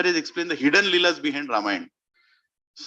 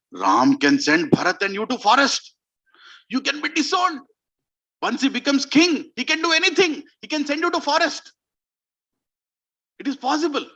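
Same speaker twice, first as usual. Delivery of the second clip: high, forceful warning that climbs; alarmist warning; 8 semitones higher than usual. Acted. Mantra warns Kaikeyi of exile, alarmist. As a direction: build the threat, voice rising high. Acts Mantra frightening the queen.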